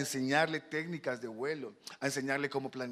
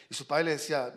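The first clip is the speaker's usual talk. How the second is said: ordinary talk, nothing marked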